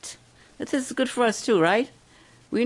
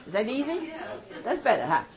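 ordinary talk

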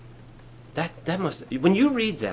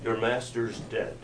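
low, soft, slow sneer; mocking scoffer taunting the disciples; scoffing Pharisee; 7 semitones lower, 10 dB quieter than usual. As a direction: taunt them coldly. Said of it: "they'll be there like".

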